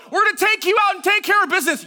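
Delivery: high, loud shouted battle cry; war cry. Acted. Soldier shouting down the battle line, fired-up war cry. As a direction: shout it fast like a rallying soldier. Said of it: "I'm shouting a war cry".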